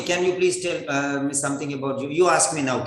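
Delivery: normal talking voice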